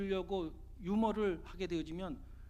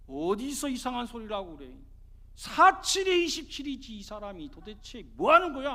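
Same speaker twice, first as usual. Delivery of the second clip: acted anger; 2 semitones higher, 6 dB louder than usual. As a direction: snap back stubbornly, getting louder. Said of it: "막 화를 내더라는 거예요". (화내며 고집스럽게 받아치기)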